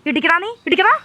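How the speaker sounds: loud, high-pitched goading; defiant dare. Acted. Woman daring the man to hit her, fiercely defiant. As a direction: dare them, voice climbing.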